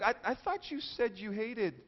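slow, halting, puzzled objection; acted hesitant disbelief; mock bewilderment. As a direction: stammer it softly, as if confused. Acts out the puzzled friend.

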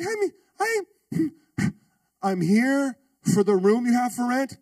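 high, slow, halting nerves; nervous stammer. Retold or imitated. Student asking the biker about the room, nervous and high. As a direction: stammer it out, scared stiff.

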